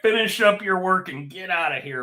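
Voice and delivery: lightly voiced boss dismissing someone; a hint of an exasperated boss; mock boss. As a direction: brush them off like a weary manager.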